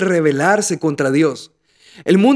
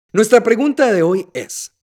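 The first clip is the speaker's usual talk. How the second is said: normal talking voice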